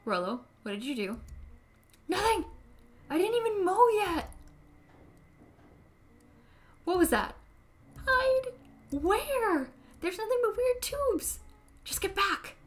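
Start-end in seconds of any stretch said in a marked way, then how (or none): 2.1-12.6 put-on high, panicky child voice; scared kid voice. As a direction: squeak it out like a frightened kid. Frightened kid caught by adult.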